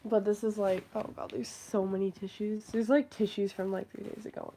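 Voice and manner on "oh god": usual speaking voice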